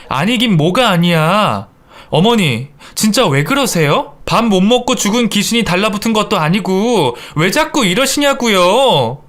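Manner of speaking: high, complaining protest; exasperated daughter-in-law. Acted. Daughter-in-law confronting mother-in-law over food, exasperated. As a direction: protest and draw out the ending.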